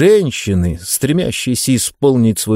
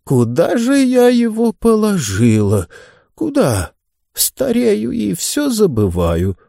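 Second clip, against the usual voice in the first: put-on a doddering old lady searching aloud; forgetful old lady; 10 semitones higher than usual. Mrs Lancaster muttering, forgetful.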